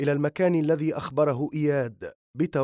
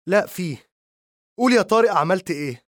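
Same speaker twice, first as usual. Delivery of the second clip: higher, louder demanding yell; 5 semitones higher, 6 dB louder than usual. A narrator's reading. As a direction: demand the truth, raising the voice. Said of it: "لتصرخ فيه".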